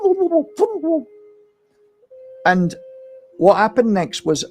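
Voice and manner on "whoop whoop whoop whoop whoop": high cooing whoops imitating a dove. Healer imitates the dove calling behind him, high whoops. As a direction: mimic a ringtail dove calling behind you. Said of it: "I heard a whoop".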